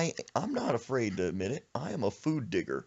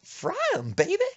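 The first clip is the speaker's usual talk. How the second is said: grandma; 5 dB louder than usual. Retold or imitated. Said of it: "She said".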